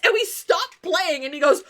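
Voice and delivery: usual speaking voice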